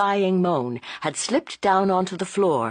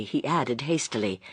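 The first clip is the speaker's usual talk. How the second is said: ordinary delivery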